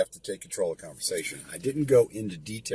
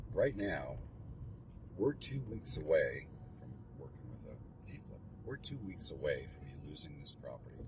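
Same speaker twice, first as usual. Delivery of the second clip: very calm; 4 semitones lower, 8 dB quieter than usual. Shown as a demonstration. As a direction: soothe an upset homeowner, quietly. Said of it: "I will very calmly say".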